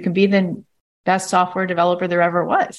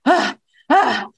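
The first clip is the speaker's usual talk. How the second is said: higher, strained little gasps; mock strained body gasps; 7 semitones higher than usual. Body imitated reacting to the threat, with mock gasps. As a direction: gasp like a body under strain. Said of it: "your body goes".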